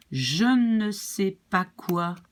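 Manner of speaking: slow "Je ne sais pas quoi" with ne stressed; slow French model. Shown as a demonstration. Teacher modelling the full French sentence. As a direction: lay each word down slowly. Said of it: "it becomes quoi".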